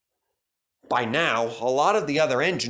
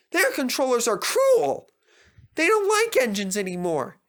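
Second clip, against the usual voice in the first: high, anxious little engine; fretting young engine voice; worried Percy voice. A narrator's reading.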